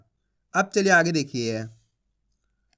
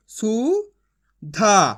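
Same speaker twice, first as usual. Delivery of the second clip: sounding out. Shown as a demonstration. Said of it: "मैं किस तरीके से एक अक्षर का उच्चारण करके".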